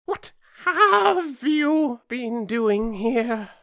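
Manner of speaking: loud, high, long-drawn interrogation; imperious Queen. A narrator's reading. Queen turning to the rose tree, imperious high demand. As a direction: demand an answer, high and slow.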